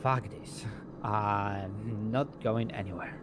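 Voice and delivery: usual speaking voice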